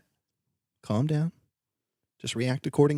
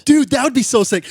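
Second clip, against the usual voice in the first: loud, high thrilled reaction; real excitement bursting out; loud high hype; excited; 9 semitones higher, 6 dB louder than usual. Real, not acted.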